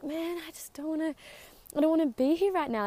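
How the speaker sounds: a half-hearted imitation of herself grumbling about getting up. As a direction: moan like you can't face the morning. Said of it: "just simply grumbling".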